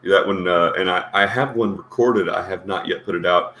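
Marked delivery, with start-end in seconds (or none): none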